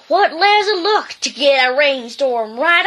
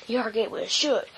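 plain reading voice

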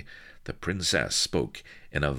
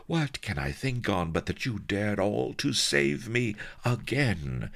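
a hint of a gushing femme fatale, higher; lightly voiced sultry temptress; 8 semitones higher than usual. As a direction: woo him like a melodramatic vamp. Sheba's lines.

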